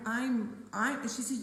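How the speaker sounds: ordinary talk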